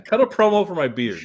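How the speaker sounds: ordinary talk, nothing marked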